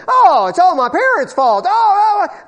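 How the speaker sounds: loud, whiny mock of a blamer; mock them whining about their parents; mocking whine.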